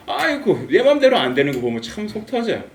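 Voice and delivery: high-pitched lament; mock exasperation; exasperated parent. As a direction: moan like a parent at wits' end.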